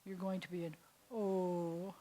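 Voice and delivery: mock sad oh. Shown as a demonstration. Teacher voices the saddest misunderstood vowel, mock sad. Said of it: "the saddest, most misunderstood vowel".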